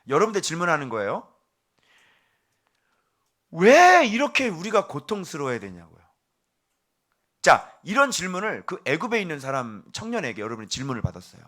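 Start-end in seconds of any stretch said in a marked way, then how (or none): 3.5-6.0 put-on indignant young man pressing his question; huffy complaint. Suffering young man demanding answers, indignant. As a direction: huff it out at the pastor. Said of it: "씩씩거리면서 와가지고".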